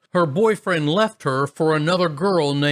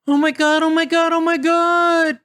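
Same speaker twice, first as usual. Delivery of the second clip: much higher, louder panicked squeal; terrified sister; 14 semitones higher, 4 dB louder than usual. Retold or imitated. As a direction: shriek in terror.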